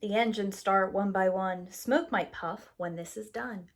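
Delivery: plain reading voice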